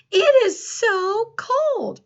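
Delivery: high, loud, slow It is so cold; excited exclamation. Shown as a demonstration. Teacher showing the class an exclamatory sentence, high and loud. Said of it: "Listen to how I say it today".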